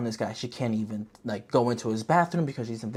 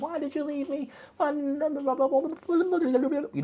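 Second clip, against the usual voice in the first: mock whiny wail; much slower than usual. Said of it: "She's like".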